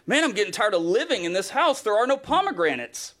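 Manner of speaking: hammed-up complaint about what's missing; fed-up griping. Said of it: "That's their experience".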